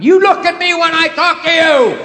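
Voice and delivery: mother yelling at her son, hammed up; mock yell. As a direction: shriek it like a furious mother. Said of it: "queen of lectures".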